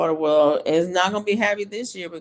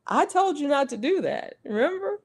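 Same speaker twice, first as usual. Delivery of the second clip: told-you-so mom; 10 semitones higher than usual. Retold or imitated. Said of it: "It's like".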